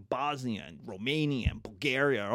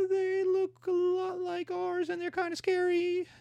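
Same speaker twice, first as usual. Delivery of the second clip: mock British alarm. British seeing Germany's new battleships, falsetto mock alarm. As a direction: fret in a silly falsetto.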